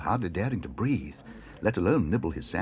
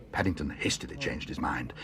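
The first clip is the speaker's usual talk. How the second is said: usual speaking voice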